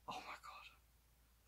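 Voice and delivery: very soft, slow stunned whisper; awed whisper. Retold or imitated. Fan showing viewers their reaction to the twist, awed whisper. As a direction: barely breathe it out, drawn out in disbelief. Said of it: "Like this".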